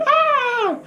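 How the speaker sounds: short, very high squeal; imitated startled shriek; mock scream. Imitates a scream in retold scene.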